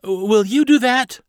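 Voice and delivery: put-on high, loud, drawn-out child's question; unsure girl captain. Carly asks Barnabas to fire the gun, high, slow and unsure. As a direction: ask it slowly, pitched right up.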